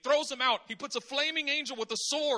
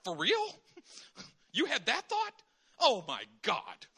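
incredulous, mock-stunned; disbelieving God. God in heaven reacting to our thoughts, incredulous. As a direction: react like you can't believe it. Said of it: "the Father's got to be in heaven like".

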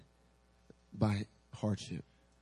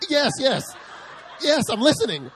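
much higher, louder, laughing reassurance; flustered yes; 13 semitones higher, 9 dB louder than usual. Retold or imitated. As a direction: hurry to show you're listening. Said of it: "I'm like".

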